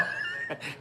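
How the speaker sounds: short, soft real laugh. Real, not acted. Storyteller amused by his binky handover, real laughter. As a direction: chuckle breathily at your own story.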